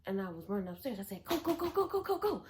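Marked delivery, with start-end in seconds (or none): 1.2-2.4 high, rushed urging; urgent go go. Retold or imitated. Telling her friend to run upstairs, high and urgent. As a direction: hurry him up the stairs.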